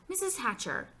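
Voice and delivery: put-on high-pitched kid's call; eager girl calling. A narrator's reading. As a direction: call for a grown-up's attention. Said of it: "Sheila called".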